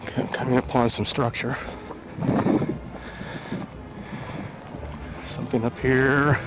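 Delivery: winded from the climb; breathless hiking talk; out of breath. Real, not acted. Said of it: "gotta catch my breath".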